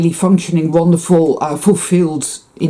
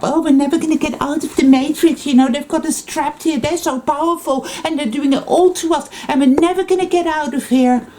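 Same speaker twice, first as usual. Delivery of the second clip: much higher, whining victim voice; mocking whiny victim; 9 semitones higher than usual. Vlogger mocking perpetual victims with a high whiny voice. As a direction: sneer at doom-mongers with a high moan.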